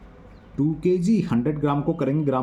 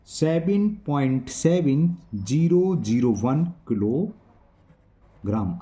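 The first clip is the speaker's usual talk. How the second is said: very slow, drawn-out digit by digit; slow dictation; much slower than usual. Real, not acted. Teacher writing out the answer for students, slow dictation. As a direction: stretch out each number as if writing it.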